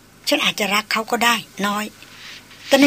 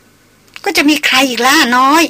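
higher, louder and slow, a curt sneering retort; curt sarcastic reply; 5 semitones higher, 5 dB louder, much slower than usual. A narrator's reading. Maria answers Darin curtly, sarcastic and sneering. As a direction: toss it back bitterly, with scorn. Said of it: "ตอบแค่นๆ พร้อมกับหัวเราะอย่างประชด".

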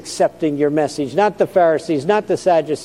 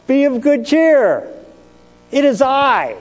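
much higher, called out slowly over the wind; Jesus calling out over the storm; 9 semitones higher than usual. Acted. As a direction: call across the water to frightened men. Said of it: "he says".

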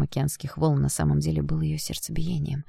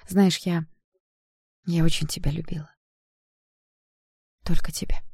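halting, higher-pitched confession of love; tender confession; 3 semitones higher than usual. A narrator's reading. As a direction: confess with long, aching pauses. Woman confesses love to gunman.

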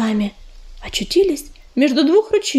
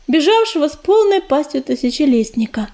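plain reading voice

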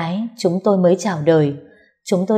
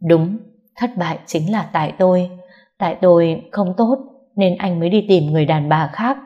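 plain reading voice

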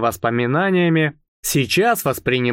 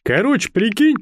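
higher-pitched, hyped-up pitch; excited startup friend; 6 semitones higher than usual. A narrator's reading. Eternal startupper messaging a friend his new idea, excited. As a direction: sell the idea with eager enthusiasm.

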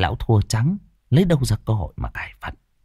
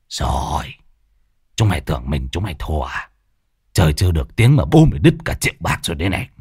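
grumbling; 6 semitones lower, faster than usual. Acted. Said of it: "Lão hậm hực".

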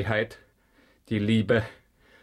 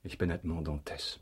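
quiet revelation; 3 semitones lower, 9 dB quieter than usual. Acted. Revealing his true identity.

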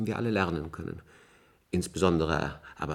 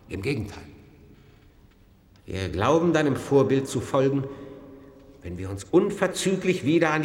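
solemn funeral eulogy. Acted.

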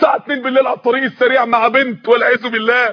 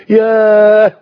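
awed exclamation; 3 semitones lower, 6 dB louder, much slower than usual. Acted. Overwhelmed by the greeting.

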